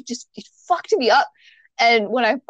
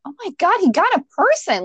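alarmed; 10 semitones higher than usual. Retold or imitated. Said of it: "And I'm like".

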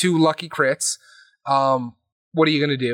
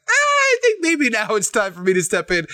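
higher, put-on voice, lightly hammed up; an offhand imitation, higher than the teller's own voice; mock Clay voice; 7 semitones higher than usual. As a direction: play him as a casual, knowing player stepping in. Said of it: "And Clay's like".

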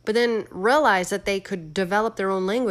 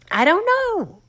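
squeaky exasperated I don't know; 14 semitones higher, 8 dB louder, slower than usual. Real, not acted. Exasperated shrug about AI.

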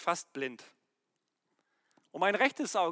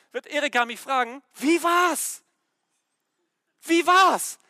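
raised, high, impassioned sermon delivery; full-force preaching; 5 semitones higher, 7 dB louder than usual. Real, not acted. Preacher urging husbands to speak love to their wives, full-force preaching. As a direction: drive it home to the men, loud and emphatic.

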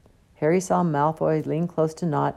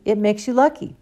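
a touch higher and louder, quick to answer; excited; 6 semitones higher, 6 dB louder than usual. A narrator's reading. Hermione answers the professor, mildly excited. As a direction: blurt it like the star pupil. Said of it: "said Hermione excitedly".

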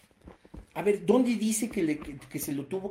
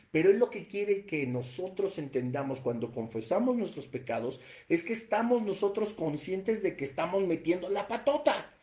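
usual speaking voice

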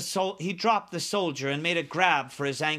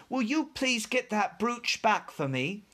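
put-on higher-pitched little girl's voice; Sophie; 6 semitones higher than usual. A narrator's reading. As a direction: ask politely in a lightly voiced girl's part. Sophie asks BFG for brooch.

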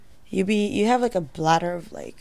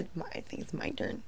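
whisper; 3 semitones lower, 21 dB quieter, much faster than usual. Real, not acted. Playful whisper claiming her turn.

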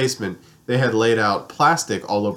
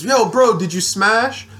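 higher, louder mock friend voice; imitating Brittany; 8 semitones higher, 3 dB louder, slower than usual. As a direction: blurt it out like a nosy buddy. Brittany asking him bluntly.

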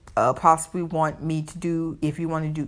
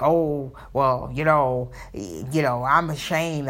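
lower, hesitant mock confession; ashamed women; 4 semitones lower, slower than usual. Playmates owning up to shame, lower hesitant voice. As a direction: imitate a woman admitting shame. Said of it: "some of these women are like".

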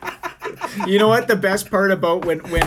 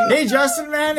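cheery greeting; 8 semitones higher than usual. Retold or imitated. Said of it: "and we're like".

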